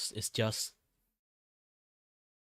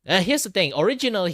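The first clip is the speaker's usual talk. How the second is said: high voice; 11 semitones higher, 11 dB louder, faster than usual. Real, not acted. Streamer opening in high voice.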